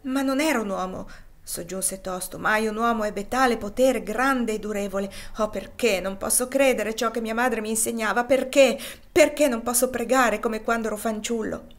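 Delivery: anguished cry. Acted. St. Clare longing for childhood faith, loud anguished plea. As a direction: cry out the why.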